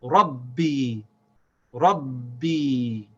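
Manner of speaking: RABBI with a held double b and long ee; no break. Shown as a demonstration. Teacher connecting rab and bi, without a break. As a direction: join RABBI unbroken, stretch the final ee. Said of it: "without stopping the sound of the ba".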